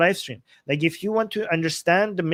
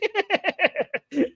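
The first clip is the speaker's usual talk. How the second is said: high giggle. Real, not acted. Laughing at own bit.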